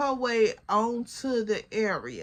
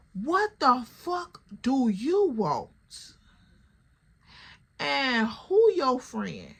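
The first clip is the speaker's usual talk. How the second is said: slow, drawn-out challenge; every word stretched with attitude; mock confrontation; 2 semitones higher, slower than usual. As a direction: imitate an irritated woman demanding an answer. Retold angry challenge to the intruder.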